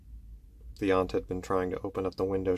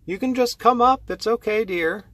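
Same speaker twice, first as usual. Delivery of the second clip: high, louder coaxing call; aunt calling up the stairs; 11 semitones higher, 4 dB louder than usual. Acted. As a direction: coax her to come up, a bit louder. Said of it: "I must be going crazy".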